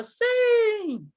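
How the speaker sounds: high shouted yes with a cry; crowd's eager yes. Retold or imitated. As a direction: shout it like the whole room answering at once. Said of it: "E aí todo mundo falava".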